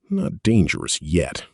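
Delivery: ordinary delivery, nothing marked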